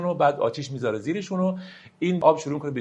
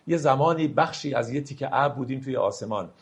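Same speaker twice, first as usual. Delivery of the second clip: ordinary talk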